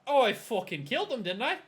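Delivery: acted indignant comeback; defensive outburst. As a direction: argue back hotly in character. Character argues back in quarrel.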